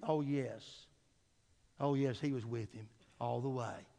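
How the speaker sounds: soft, low reassurance; hushed affirmation. Real, not acted. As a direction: drop to a near hush.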